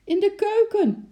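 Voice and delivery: very high, loud scared cry; wife shrieks where the monkey is; frightened call. A narrator's reading. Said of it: "riep zijn vrouw". (bang roepend)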